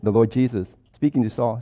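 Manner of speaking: ordinary talk, nothing marked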